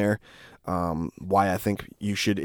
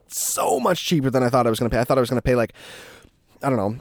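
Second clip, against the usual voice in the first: normal talking voice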